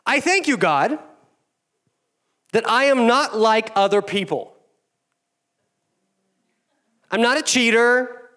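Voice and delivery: high, loud, self-satisfied praying; smug Pharisee prayer. Acted. Pastor mocking the Pharisee's prayer in a high smug voice. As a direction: preen before God like a proud Pharisee. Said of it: "his beautiful, wonderful, elegant prayer".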